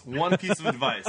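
ordinary talk, nothing marked

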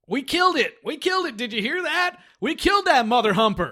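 high, gleeful and a bit loud; excited quote. Retold or imitated. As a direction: celebrate the kill over the radio. Quoting Michael Gross on radio.

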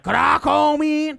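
catchphrase scream. Shown as a demonstration. Host shows the guest how to scream the catchphrase, high and slow. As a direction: wail the catchphrase slowly. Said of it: "Scream it like".

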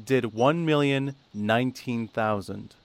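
slow, drawn-out ratings announcement; deliberately slow. Real, not acted. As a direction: stretch out each part of the number. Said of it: "I'm gonna say this really slowly".

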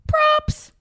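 high, drawn-up excited announcement; excited reveal. Real, not acted. Co-host revealing photo shoot surprise, excited high squeal. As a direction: pop it out high like a big reveal.

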